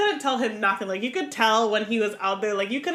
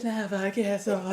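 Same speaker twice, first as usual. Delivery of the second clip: softer, breathy and out of breath; mock breathless whisper; 3 semitones lower, 5 dB quieter than usual. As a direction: gasp it out with a whisper. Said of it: "out of breath every time".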